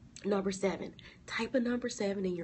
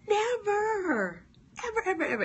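never, ever much higher and louder; emphatic nevers; 12 semitones higher, 5 dB louder than usual. Acted. Preacher insists to viewers the Lord never lets them fall. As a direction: hammer every never and ever.

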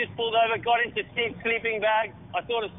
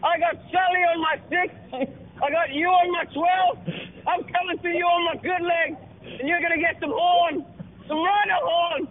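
higher, hammed-up tough-guy taunt; taunting the French rival, a hammed-up higher tough-guy boast; mock action-hero threat; 8 semitones higher than usual. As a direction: play the swaggering fighter pilot for laughs. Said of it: "I said".

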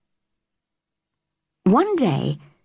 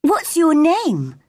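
put-on young girl voice; 8 semitones higher, slower than usual. A narrator's reading. Mary asks the gardener.

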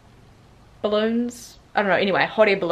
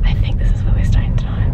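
hushed whisper; 7 dB louder than usual. Real, not acted. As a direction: whisper it like a secret. Sneaky guess while driving.

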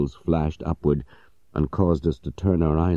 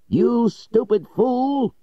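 much higher, slow, furious shout of a master; shouted insult; 3 dB louder, much slower than usual. Acted. Master shouting at his servant, furious high yell. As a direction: bellow it at the fool, stretched out. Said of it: "he shouted".

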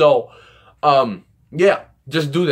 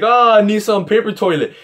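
mock desperate cry; 5 semitones higher, 3 dB louder than usual. Teasing her with a made-up bathroom panic, mock desperate cry. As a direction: call out in joking panic. Mock call for toilet paper.

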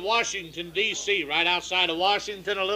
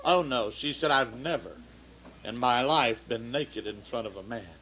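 softer and lower, a shy shocked waitress; voiced waitress; 8 semitones lower, 6 dB quieter than usual. Waitress to the doctor, soft and low. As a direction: hush it like a prim patient refusing.

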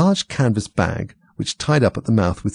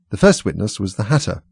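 usual speaking voice

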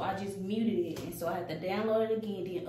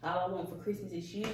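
normal talking voice

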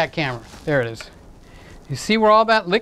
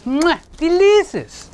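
chef's kiss; 10 semitones higher, slower than usual. Pretend. Chef's kiss over food.

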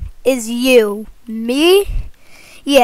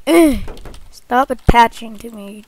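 ordinary talk

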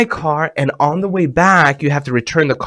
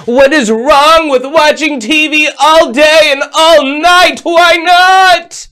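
put-on high, loud pleading kid voice; whiny kid; 13 semitones higher, 7 dB louder, slower than usual. Host voicing kids complaining about TV limits, high whiny protest. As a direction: whine like a child begging for more TV.

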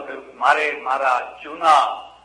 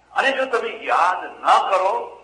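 slow warning; 5 semitones higher, slower than usual. Real, not acted.

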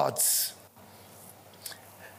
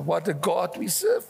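ordinary talk

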